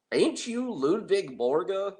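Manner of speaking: high, slow bad Southern drawl; a hammed-up Southern accent, slowly. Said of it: "I do terrible accents".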